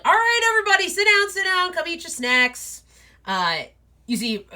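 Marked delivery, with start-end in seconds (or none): 0.0-2.8 much higher, loud host voice; booming wolf dad. Acted. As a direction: hurry everyone to sit, big and bright. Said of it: "Big Dad's like".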